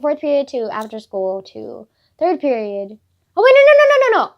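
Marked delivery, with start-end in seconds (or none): 3.3-4.3 high, loud string of no's; real fluster, pitched way up; flustered self-correction. Real, not acted. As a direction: cut yourself off in a squealed correction. Catches own mistake in story order.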